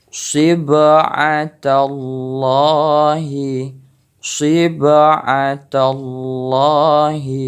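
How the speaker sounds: very slow "sib'atallahi" with the b bouncing; qalqalah on ba. Shown as a demonstration. Teacher demonstrating qalqalah, slow bounced ba. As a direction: let the ba in "sib'atallahi" rebound.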